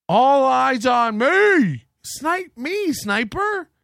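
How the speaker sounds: high, slow showboating taunt; taunting dare. Pretend. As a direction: call out the sniper like a show-off.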